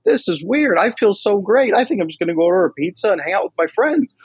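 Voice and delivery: high-pitched imitation of a sheepish friend; relieved buddy. Buddy tells coach he feels great now, high imitated voice.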